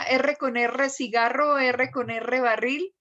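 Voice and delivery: every "R" rolled on the tongue tip; trilled R drill. Shown as a demonstration. Teacher recites the classic drill, rolled R. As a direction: recite "cigarro" and "barril" with rolled rr. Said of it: "que le hacen a uno, diga".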